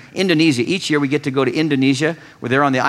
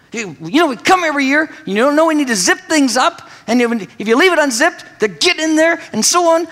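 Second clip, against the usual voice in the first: raised, higher preachy scolding tone; mock lecture; 11 semitones higher, 3 dB louder than usual. Husband lecturing his wife about the unzipped bag, higher and insistent. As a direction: lay into her with a know-it-all lecture. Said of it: "So I give her the, you know, the, the lecture".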